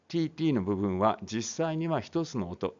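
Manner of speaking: usual speaking voice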